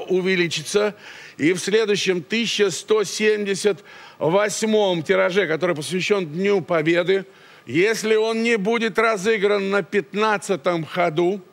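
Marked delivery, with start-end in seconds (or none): none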